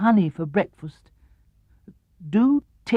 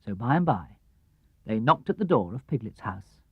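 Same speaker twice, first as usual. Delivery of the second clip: ordinary delivery, nothing marked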